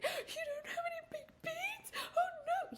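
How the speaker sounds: high retold crying wail over beans; hysterical crying. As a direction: sob it like a heartbroken child. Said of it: "I was hysterical crying".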